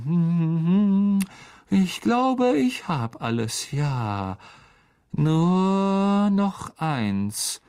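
high, sing-song humming and pondering; musing Lockhart. A narrator's reading. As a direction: draw out the words while taking stock. Lockhart musing while packing.

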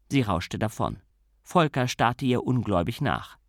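plain reading voice

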